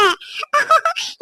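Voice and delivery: giddy high laugh. Acted. Wife giddy over pregnancy news.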